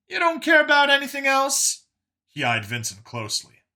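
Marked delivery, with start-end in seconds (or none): 0.1-1.8 high, loud disbelief; Brian's incredulous voice. Acted. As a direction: press him, pitched way up and loud. Brian presses Vincent.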